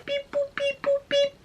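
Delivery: high squeaky beep boop dialing; phone dial beeps. Retold or imitated. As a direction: imitate dialing a phone number. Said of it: "call them up".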